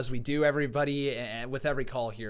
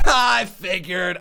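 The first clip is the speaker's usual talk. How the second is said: laughing it out, big and slow; 3 semitones higher, 11 dB louder, much slower than usual. Real, not acted. Host laughing loudly as the caller says he's pissed. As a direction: burst out laughing while answering.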